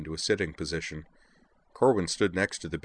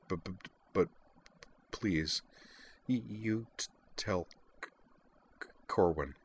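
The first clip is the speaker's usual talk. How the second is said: murmured; 8 dB quieter than usual. A narrator's reading. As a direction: barely breathe the words. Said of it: "she murmured".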